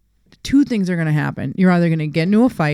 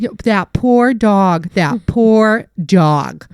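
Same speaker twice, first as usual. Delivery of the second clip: slow, drawn-out sarcastic pity; mock pity; 3 semitones higher, 5 dB louder, much slower than usual. Sarcastic reply to the bystanders, slow mock pity. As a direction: stretch out poor dog sarcastically. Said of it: "I should have just been like".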